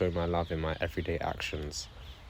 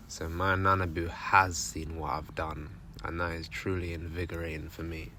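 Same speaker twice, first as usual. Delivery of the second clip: ordinary delivery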